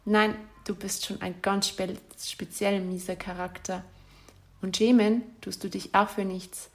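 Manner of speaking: ordinary talk, nothing marked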